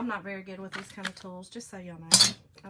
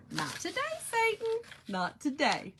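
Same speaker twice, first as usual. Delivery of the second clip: higher, sassy put-on voice for the slogan; hammed-up sassy catchphrase; 8 semitones higher than usual. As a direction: fling it at the devil with attitude. Performing the t-shirt slogan.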